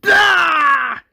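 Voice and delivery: long, high, loud acted scream; piercing cry of agony; scream of pain. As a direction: scream as if stabbed. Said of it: "as Rick screams".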